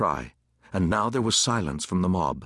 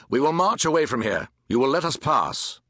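higher, projected officer's order; firm command; 4 semitones higher than usual. A narrator's reading. Captain before his soldiers tells mob, commandingly. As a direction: address the crowd with authority.

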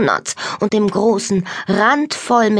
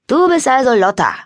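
higher voice, sizing up the newcomer; suspicious boy; 5 semitones higher, 4 dB louder than usual. A narrator's reading. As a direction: say it as a wary young cousin. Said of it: "sah Lotta argwöhnisch an".

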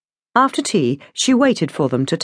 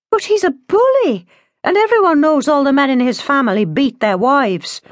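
put-on much higher young woman's voice; shocked protest; 10 semitones higher, 4 dB louder than usual. A narrator's reading. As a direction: object in high-pitched alarm.

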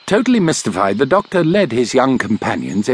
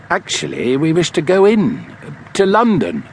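ordinary delivery

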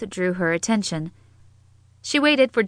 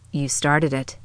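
pitched down, low reply; 5 semitones lower than usual. A narrator's reading. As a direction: drop the voice low. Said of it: "Jinx's tone was low".

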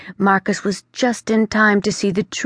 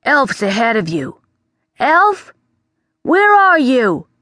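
much higher, louder call into the trees; 7 semitones higher, 5 dB louder than usual. Acted. The bat calls out to Elf in the forest. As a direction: hail someone far away.

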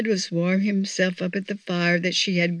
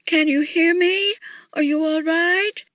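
high, raised calling voice; screamed call; 10 semitones higher than usual. A narrator's reading. Missus Claus calling to lost Santa, a storybook scream. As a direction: call out high, hoping for an answer. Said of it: "screamed Missus Claus at the top of her lungs".

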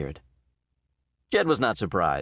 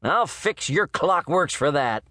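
shouted threat; 6 semitones higher than usual. A narrator's reading. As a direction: promise revenge at the top of his voice. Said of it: "Jed shouted".

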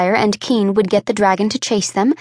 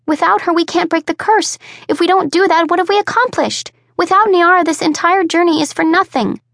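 put-on higher, urgent young woman voice; young woman, distraught; 8 semitones higher than usual. A narrator's reading. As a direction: plead it as a desperate girl.

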